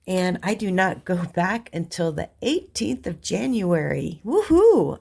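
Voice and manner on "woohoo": high, loud celebratory whoop; delighted woohoo, swooping up high; happy cheer. Real, not acted. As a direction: cheer it out, pitched way up. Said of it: "I am so happy about that".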